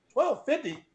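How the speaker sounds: high-pitched incredulous outburst; acted-out stunned realization; retold astonishment. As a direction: let the disbelief burst out high, near laughing. Retold shock when it clicks.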